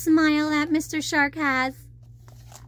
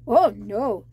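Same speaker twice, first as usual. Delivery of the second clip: shark reply; 5 semitones lower than usual. Acted. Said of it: "the shark replied".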